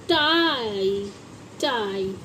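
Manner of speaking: टाई stretched slow, falling low; drawn-out demo word. Shown as a demonstration. Teacher showing pupils the long vowel, slow. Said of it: "आई या आए".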